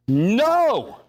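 loud, high, sharp No; a shouted No; emphatic no. Real, not acted. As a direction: slam the door on the idea. Mocking the naive hope.

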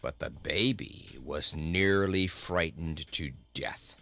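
low, scornful goat voice; grumpy critical goat; surly goat. A narrator's reading. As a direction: declare it deep and dry.

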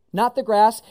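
ordinary talk

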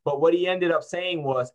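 usual speaking voice

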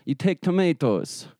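put-on high, hammy Russian accent; broken English in a mock Russian accent. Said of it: "Yeah, you have good accent".

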